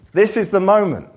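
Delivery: loud, high, ringing declaration; loud proclamation. Acted. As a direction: proclaim it to the whole church. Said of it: "repeated line, first loud".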